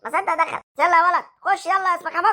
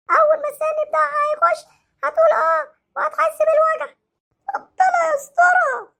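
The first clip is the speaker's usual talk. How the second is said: put-on much higher, whiny child's voice; hurt boy threatening the doctor with his dad; sulky hurt child; 11 semitones higher, slower than usual. Same child.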